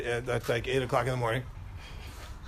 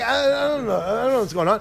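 ordinary talk, nothing marked

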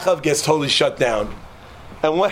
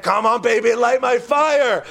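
high, slow, stretched-out plea; half-sung mock yell, drawing out the last word; mock scream; 5 semitones higher, slower than usual. Baal prophet begging for fire, long high mock scream. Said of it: "Why don't you scream a little louder".

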